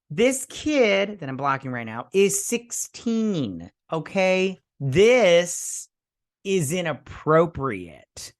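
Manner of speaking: high, slow, emphatic outrage; exaggerated indignation. Viewer objecting to a sixteen-year-old making out, indignant. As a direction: stretch each key word in appalled protest. Indignant reaction to the make-out scene.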